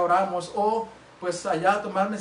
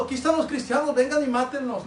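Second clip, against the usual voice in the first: mock shouting; 6 semitones higher, 5 dB louder than usual.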